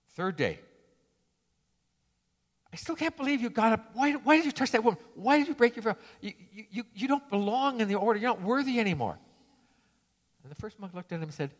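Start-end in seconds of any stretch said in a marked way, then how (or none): 2.7-9.2 high, indignant accusation; angry scolding. Acted. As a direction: tell him he's unworthy. Monk scolds third day.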